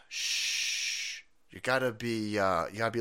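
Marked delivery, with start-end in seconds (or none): 0.1-1.2 soft, slow shush. Retold or imitated. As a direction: hush him like a handler. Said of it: "Bring it down a little bit".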